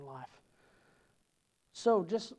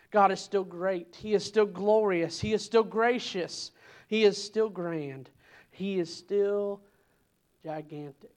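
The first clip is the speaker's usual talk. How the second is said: preaching; 2 semitones higher, 6 dB louder than usual. Acted. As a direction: proclaim each line like a revival preacher. Preaches God is still God.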